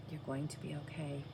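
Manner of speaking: soft, hushed comfort to oneself; whispered reassurance. Retold or imitated. Mother reassuring herself after nearly dying, whispered. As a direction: whisper it to calm yourself down. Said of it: "I just kept saying to myself".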